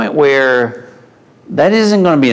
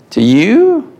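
pitched up high in disbelief; incredulous; 8 semitones higher than usual. Acted. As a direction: ask To you? as if it's unthinkable. Incredulous repeat, much higher.

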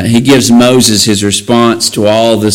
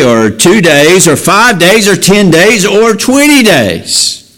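higher and higher, hammering each count; rising preaching; 9 semitones higher than usual. Acted. Preacher driving home God's promise of meat. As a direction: build the list to a peak.

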